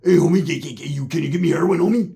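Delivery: a light put-on street voice, begging; Joey Stacks impression. Imitating the two talking, lightly put-on homie voice. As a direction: mimic a stammering homie. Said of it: "it's like hearing Joey Stacks like".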